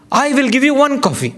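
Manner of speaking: high, quick barista promise; a hint of a brisk Italian barista; lightly voiced barista. Barista explaining suspended coffee to the speaker, high and quick. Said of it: "He says".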